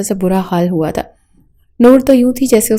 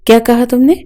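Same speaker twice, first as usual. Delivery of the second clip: higher and louder, rising in disbelief; furious outburst; 4 semitones higher, 3 dB louder than usual. A narrator's reading. Husband repeating his wife's words to her, exploding in anger. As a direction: repeat it, now blowing up. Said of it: "اس کا لہجہ اتنا بلند تھا".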